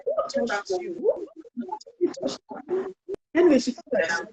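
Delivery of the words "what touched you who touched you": higher, louder, startled mother asking; alarmed mother. Retold or imitated. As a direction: play a mother shocked by the news, the second question slow. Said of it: "the mom was like".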